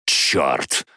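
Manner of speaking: low, grim curse; gloomy mutter. Acted. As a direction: mutter it darkly, pitch dropped. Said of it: "Меланхтон пробормотал это так мрачно".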